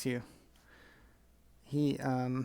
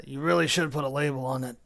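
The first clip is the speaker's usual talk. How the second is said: hollered; 6 dB louder than usual. Acted. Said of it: "That was hollered".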